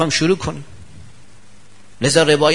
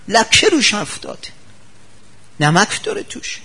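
fussy scolding; 7 semitones higher than usual. Acted. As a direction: lay into the servant. Fussy master lectures loudly.

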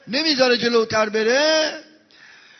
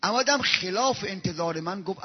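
ordinary delivery

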